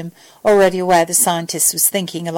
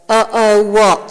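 slow, mildly angry retort; 4 dB louder, slower than usual. A narrator's reading. Sam demands Al explain his uh-oh, mildly angry. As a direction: throw it back slowly, with a touch of anger. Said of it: "Sam retorted angrily".

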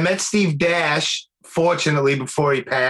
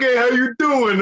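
put-on higher-pitched teasing Brooklyn accent; mocking Brooklyn accent; 7 semitones higher than usual. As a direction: imitate someone poking fun at a Brooklyn accent. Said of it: "He was making fun of me".

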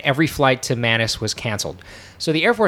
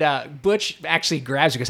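ordinary talk

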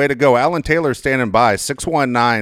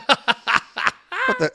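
laughing disbelief; 11 semitones higher than usual. Retold or imitated. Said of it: "And I was like".

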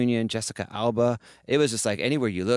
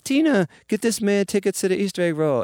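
pitched up high and louder, a grand command; high imitation giving orders; 12 semitones higher, 6 dB louder than usual. Imitating the first lady ordering tickets for the DJ, high and loud. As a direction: announce it like Oprah handing out prizes. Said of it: "She's like".

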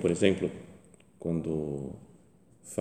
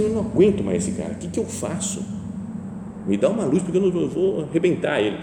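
louder, fed-up complaint to God; exasperated venting; 6 semitones higher, 3 dB louder than usual. Pretend. Praying to Christ about an unbearable neighbour, fed up and loud. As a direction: blurt it out like you're at the end of your rope. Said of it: "Fala assim".

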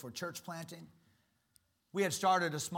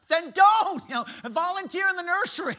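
mock exasperated; 14 semitones higher, 13 dB louder than usual. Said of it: "I'm like".

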